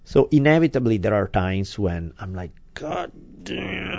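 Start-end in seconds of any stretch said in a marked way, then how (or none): 2.7-4.0 soft, tight muttered curse; frustrated mutter. Retold or imitated. Parent fed up with a dawdling child, muttered exasperation. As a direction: curse under your breath, barely let out. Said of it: "I just got so mad".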